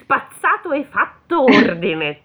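put-on high, loud nagging old granny; scolding grandma. Grandmother scolding the devil, high and loud. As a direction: scold him like a fussy grandmother.

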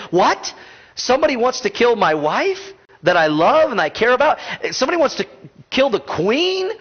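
king stunned by the news; shocked king. Retold or imitated. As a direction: react like a husband hearing his wife is in danger. King hears threat to wife.

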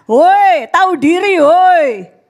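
loud call, woi; mock scolding shout. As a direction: shout a rebuke at the crowd. Preacher calls out.

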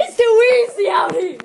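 loud, high cocky boast; cocky shout. Retold or imitated. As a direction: brag it at full volume. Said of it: "he's like".

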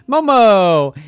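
high, loud whoop of a word; silly nonsense. Acted. Yvette saying silly things to herself, silly nonsense. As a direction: sing it out loud and goofy. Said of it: "we could say silly things".

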